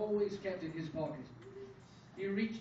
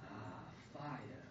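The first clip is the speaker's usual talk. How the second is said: low, soft, drawn-out savouring; stated with satisfaction; 9 semitones lower, 6 dB quieter, slower than usual. A narrator's reading. Father admires the lit candles, quietly satisfied. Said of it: "stated Joseph's father".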